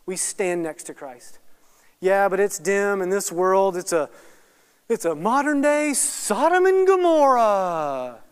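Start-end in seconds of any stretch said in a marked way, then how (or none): 2.0-8.2 mock complaining. Churchgoer moans about this dim world, mock complaining. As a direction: imitate a doom-laden complainer. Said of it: "stand your ground and stop complaining".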